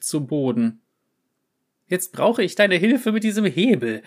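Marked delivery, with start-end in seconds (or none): 1.9-4.0 high, loud yowl; desperate cat yowling. Acted. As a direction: yowl for help. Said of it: "jaulte von Jip".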